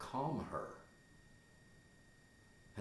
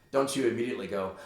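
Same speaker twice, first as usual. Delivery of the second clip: ordinary talk, nothing marked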